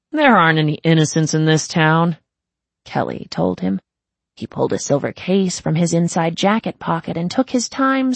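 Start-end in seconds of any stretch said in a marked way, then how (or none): 0.1-2.2 cynical gang boss. Acted. Kelly's voice.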